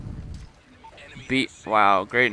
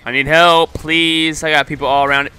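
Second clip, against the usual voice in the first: loud plea for help; 4 semitones higher, 6 dB louder than usual. Real, not acted. Begging teammates under pressure.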